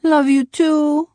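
put-on slow, high little-boy voice; drawn-out drowsy murmur of a small child; sleepy child's murmur. A narrator's reading. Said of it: "murmured Andrew".

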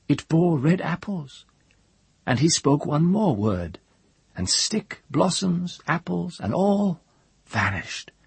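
high-pitched storyteller character voice; elevated, incantatory tale-telling with dramatic pauses; Thar recounting a marvel. A narrator's reading. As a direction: tell the wonder pitched well up, phrase by phrase. Thar tells her tale in character.

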